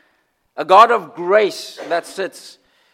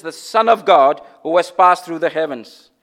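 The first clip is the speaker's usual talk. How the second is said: normal talking voice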